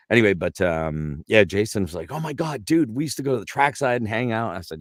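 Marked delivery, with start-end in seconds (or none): 2.1-4.5 higher-pitched retold enthusiasm; excited reminiscence. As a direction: imitate an old buddy thrilled to remember. [name] excitedly recalls shared old times.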